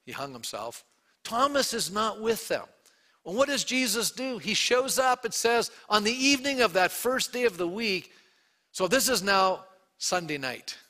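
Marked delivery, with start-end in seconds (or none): none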